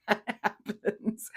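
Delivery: words broken up by laughter; laughing. Real, not acted. Amused at that's different, laughing through it.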